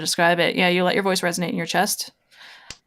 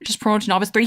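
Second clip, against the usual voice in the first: normal talking voice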